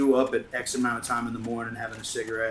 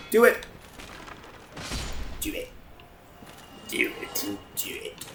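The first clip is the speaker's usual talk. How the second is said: villain taunt fading; 10 semitones higher, 3 dB quieter than usual. Pretend. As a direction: goad them, then drop to a whisper.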